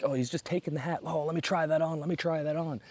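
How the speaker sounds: put-on high, eager drunk pleading; drunk friend voice. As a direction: beg like a happy drunk. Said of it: "he's like".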